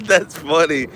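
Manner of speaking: laughing it out slowly; a real laugh at the chat. Real, not acted.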